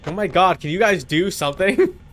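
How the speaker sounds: ordinary talk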